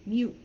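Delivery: "mute" with the final T left unreleased; American "mute", T held back; muted T. Shown as a demonstration. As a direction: stop "mute" short without sounding the T. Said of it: "doesn't have a T sound to it".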